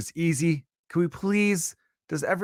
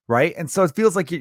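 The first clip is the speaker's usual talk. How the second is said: ordinary talk, nothing marked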